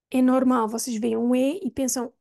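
ordinary talk, nothing marked

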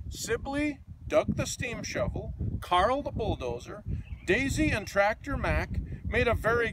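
lively, sing-song picture book reading; reading to children. A narrator's reading. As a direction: read it like a bedtime storybook for kids.

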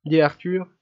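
plain reading voice

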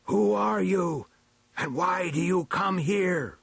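high, slow, imperious demand; chief elder questioning. A narrator's reading. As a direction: draw out the challenge from on high. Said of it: "Then the chief elder again asked".

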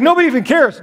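ordinary talk

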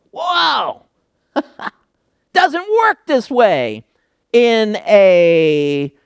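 high, amazed whoa with a laugh; playful disbelief at a master serving; mock astonishment. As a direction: act shocked at the reversal, then laugh. Surprised reaction to master serving.

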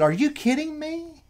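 high, slow incredulous retort; retold disbelief. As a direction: ask it as if you can't believe her. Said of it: "I said".

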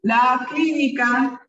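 each word drawn out slowly for the class; teacher dictating slowly; slow dictation. Shown as a demonstration. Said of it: "voy a ir dictando".

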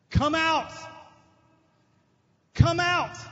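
Jesus calling into the tomb; crying out. Acted. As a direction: call the dead man out of the tomb. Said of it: "And he cried out".

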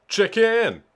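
high, loud exclamation; calling out. Real, not acted. Player shouts at a chicken in the game, high loud call.

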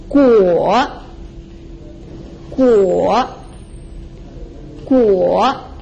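guo drawn out, dipping then rising; falling-rising tone. Shown as a demonstration. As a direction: drop guo low and lift the end. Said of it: "a falling and rising tone".

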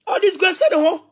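lightly mocking the apostle; mocking put-on voice. Shown as a demonstration. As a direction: toss the refrain off in a sneering put-on voice. Repeated refrain in changed voice.

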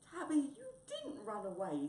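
high, loud glad exclamation; delighted relief. Acted. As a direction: burst out on seeing a friend return. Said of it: "said Wombat".

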